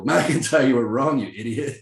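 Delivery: high, loud heckle from angry fans; mock angry fans. Fans turning on their scapegoat, mock outrage. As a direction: hurl blame like an irate crowd. Voicing fans blaming him.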